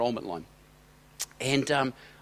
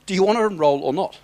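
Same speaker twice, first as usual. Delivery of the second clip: louder, higher, fed-up clerk demanding an answer; raised-voice exasperation, retold; exasperated; 6 semitones higher, 7 dB louder than usual. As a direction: snap it out as an exasperated cashier losing patience. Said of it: "She gets exasperated and she goes".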